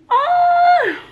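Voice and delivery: sudden high yelp of surprise; surprised yelp. Real, not acted. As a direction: squeak it out, caught off guard. Surprise while opening the mail.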